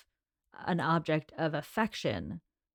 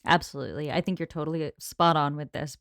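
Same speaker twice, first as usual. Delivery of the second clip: ordinary delivery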